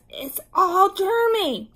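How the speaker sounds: put-on high, loud childish whine; upset squabbling child; loud protest. A narrator's reading. Children squabble over germy lollipop.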